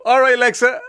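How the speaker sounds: lightly acted wake-up call; morning greeting to Alexa. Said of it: "you go".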